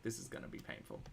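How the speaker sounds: whispered aside. Real, not acted. Aside while following logic.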